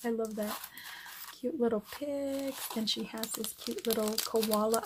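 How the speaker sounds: ordinary talk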